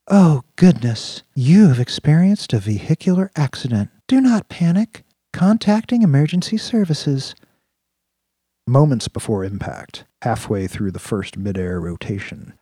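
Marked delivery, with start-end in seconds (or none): 0.1-7.3 ultra-sensual voice. A narrator's reading. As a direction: purr it like a seductive AI. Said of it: "Jessica Lopez' ultra-sensual voice stated on the comm".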